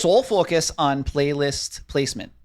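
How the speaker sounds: slow, loud, strong Minnesota accent. Shown as a demonstration. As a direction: lay on the Minnesota vowels. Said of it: "say the rest ... strong Minnesota accent".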